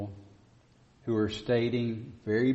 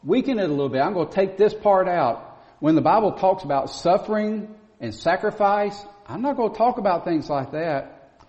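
lampoon the preacher who waters it down; rising to a squeaky peak on "kind of believe"; mocking a watered-down preacher; 6 semitones higher, 5 dB louder than usual. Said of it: "They'll say".

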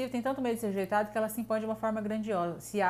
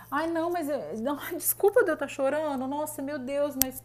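high, fake teary apology with a laugh; mock crying victim; 6 semitones higher, 4 dB louder than usual. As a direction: fake sobbing for pity, hammed up. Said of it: "que você olha e fala". (imitando choro falso de vítima, voz aguda)

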